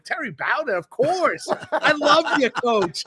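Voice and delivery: high, gushing fan delight; retold enthusiasm. Guest retelling his yes to coming on with the coach, gushing enthusiasm.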